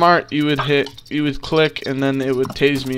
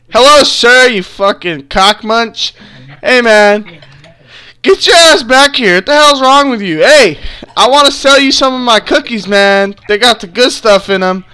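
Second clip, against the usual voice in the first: loud, higher, taunting street hustler; rowdy suspect; 7 semitones higher, 8 dB louder than usual. Acted. Suspect taunting the police trainee, loud and rude. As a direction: heckle the cop like a rude troublemaker.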